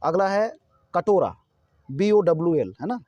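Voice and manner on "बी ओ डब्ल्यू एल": ordinary delivery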